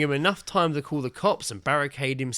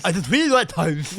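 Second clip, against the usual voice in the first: swollen tongue voice; 3 semitones higher, 4 dB louder than usual. Pretend. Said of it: "that voice".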